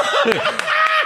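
a burst of high-pitched laughter. Real, not acted. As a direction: crack up with joy. Rires sur le verlan. (grand éclat de rire aigu)

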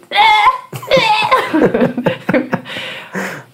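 a high burst of real laughter; big laugh. Real, not acted. Cracking up at her own workout yell, big high laugh.

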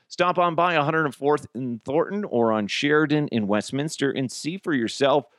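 ordinary delivery

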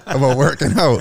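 laughing through the words; amused disbelief breaking into a laugh; chuckling while quoting the kid. Retold or imitated. Son retelling Ray's lecture, laughing.

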